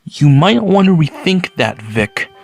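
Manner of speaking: ordinary talk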